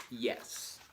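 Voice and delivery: normal talking voice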